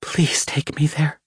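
breathless dying whisper. A narrator's reading. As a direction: plead with barely any breath left. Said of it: "her voice growing fainter".

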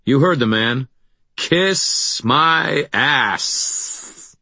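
high, drawn-out mocking jeer; defiant taunt. A narrator's reading. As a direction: taunt them slowly. Taunting the attacker.

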